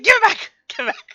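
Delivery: high, loud burst that eases on the repeat; mock yelled demand. She replays the character's demand, mock yell. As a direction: shout it in played-up outrage.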